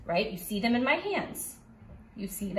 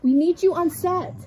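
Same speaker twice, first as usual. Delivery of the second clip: higher, louder desperate assistant; frantic stressed assistant; 6 semitones higher, 7 dB louder than usual. Pretend. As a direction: call out to the star in a panic. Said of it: "he's having a diva moment".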